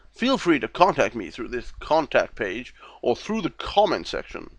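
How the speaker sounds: ordinary delivery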